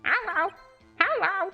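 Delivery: high squawky parrot hello. Acted. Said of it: "the parrot voice is not easy".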